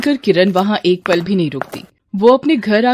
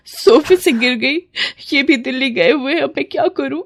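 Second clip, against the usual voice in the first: higher, distressed answer; tearful helplessness; 5 semitones higher than usual. Acted. Mother telling neighbour of the fall, helpless and tearful. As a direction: let the worry push the voice up. Frightened mother explains injury.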